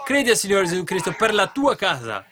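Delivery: loud, high preaching; forceful exhortation. Real, not acted. Preacher urging belief for one's household, forceful exhortation. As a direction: drive the command home to the congregation.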